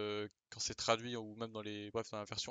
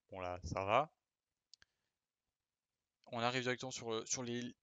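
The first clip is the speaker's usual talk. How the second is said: normal talking voice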